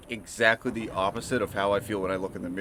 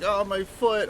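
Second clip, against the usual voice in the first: fake pain cry; 12 semitones higher, 5 dB louder, slower than usual. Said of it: "I sold it".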